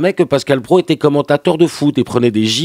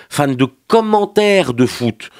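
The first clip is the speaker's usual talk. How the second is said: "commentaires" stretched out slowly and raised in pitch; stress on commentaires; 4 semitones higher, much slower than usual. Acted. Comedian corrects fan of football to commentary, "commentaires" drawn out. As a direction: draw out "commentaires" to mark the distinction.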